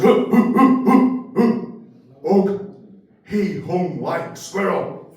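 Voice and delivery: hammed-up caveman grunting out a joke; caveman grunts. Caveman telling first joke.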